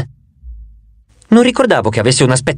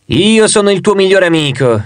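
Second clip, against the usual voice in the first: higher, lightly voiced friendly face; the two-faced god's friendlier side, higher; 4 semitones higher than usual. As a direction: play the good face, pitched up. Two faces, friend versus enemy.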